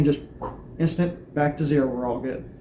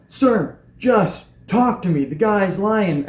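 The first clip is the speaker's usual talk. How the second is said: pleading; 5 semitones higher, much slower than usual. Retold or imitated. Pleading with officer holding taser.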